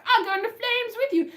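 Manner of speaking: mock queen. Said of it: "And she's like".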